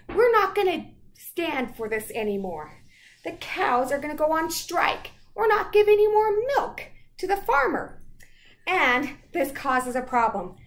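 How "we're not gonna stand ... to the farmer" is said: cows' protest, high and loud; a hint of striking cows; mock protest. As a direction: protest like a defiant cow. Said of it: "you say".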